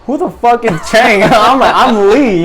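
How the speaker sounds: high-pitched, loud indignant protest; indignant disbelief. Retold or imitated. Marine correcting the corpsman who called him Chang, indignant.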